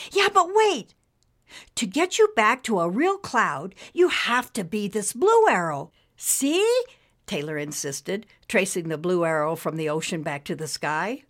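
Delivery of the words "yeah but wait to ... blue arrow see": put-on high, eager young girl voice; girl character voice. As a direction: urge it like a keen kid. Taylor explains again.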